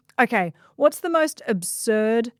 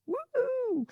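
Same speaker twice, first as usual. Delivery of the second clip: a high, bright cheer to close the intro; excited whoop; 7 dB quieter than usual. Real, not acted. Host cheering her own intro, high excited whoop. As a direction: whoop like the crowd just went wild.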